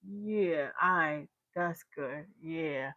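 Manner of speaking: low, very soft, slow approval; underplay it, quiet and unbothered; imitated cool rapper. Rapper approving a coughing fan, imitated low-key cool. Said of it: "he looked at me. He was like".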